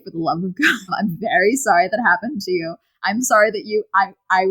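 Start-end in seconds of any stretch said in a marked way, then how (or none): none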